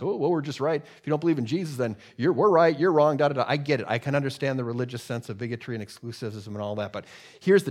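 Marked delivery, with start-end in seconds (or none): 0.0-3.4 lifted, insistent know-it-all parody; mock self-righteous. Preacher mimics believers who come across as bigoted, mock self-righteous. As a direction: imitate smug believers, getting louder on the verdict. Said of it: "Sometimes you can come across as".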